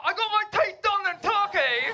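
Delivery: high, loud put-on voice bragging about new teeth; mock bragging. Comic imitating a proud tourist, high and loud put-on voice.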